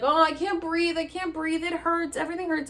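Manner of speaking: high, whining re-enacted distress; pained panic. Patient to helpers while unable to breathe, acted pained panic. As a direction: plead it as if hurting all over.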